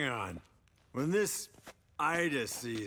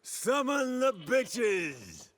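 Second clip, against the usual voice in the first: excited cheer; 8 semitones higher, much slower than usual. Acted. Drunk cheer.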